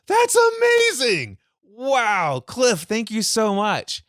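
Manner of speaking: high, slow, amazed and delighted; amazed client. Retold or imitated. Client thanking Cliff for fixing the line, amazed and high. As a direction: gush with wonder at the fix.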